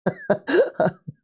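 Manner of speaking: a high burst of laughter; giggling high at the screen. Real, not acted. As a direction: laugh out at the clumsy play. Laughing at Beto.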